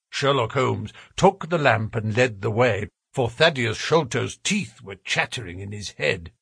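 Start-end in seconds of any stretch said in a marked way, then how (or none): none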